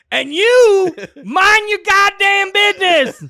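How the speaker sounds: mother turning on a stranger, yelling; furious shout. Retold or imitated. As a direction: yell it right in his face. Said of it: "she gets in my face".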